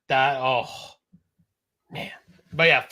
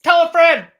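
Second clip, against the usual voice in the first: loud, high-pitched plug; mock promo shout; 12 semitones higher, 11 dB louder than usual. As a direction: yell it out like a hammed-up slogan.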